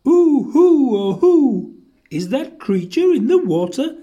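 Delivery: high, loud hoots into a question; hoot, then ask in wonder. A narrator's reading. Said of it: "he asked them".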